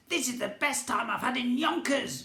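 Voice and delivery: high, loud, gleeful goblin voice; gleeful goblin prince. A narrator's reading. Goblin prince gloating over wrecking the hotel, high and gleeful. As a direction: squeal it like a delighted little goblin.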